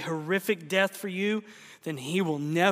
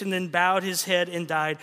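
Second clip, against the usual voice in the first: ordinary talk, nothing marked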